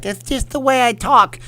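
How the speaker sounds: high, loud lisping boxer; squeaky-pitched champ defending himself; Mike Tyson. Acted. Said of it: "my Mike Tyson meets".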